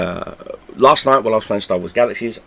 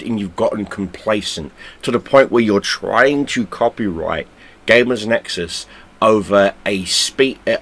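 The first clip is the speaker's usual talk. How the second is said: ordinary talk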